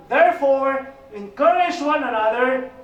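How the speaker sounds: loud, slow proclaimed verse; preacher proclaiming a Bible verse to the church; scripture reading. Real, not acted. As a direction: read the verse out with weight, slowly. Reads scripture to congregation.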